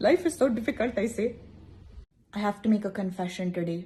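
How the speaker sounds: ordinary talk, nothing marked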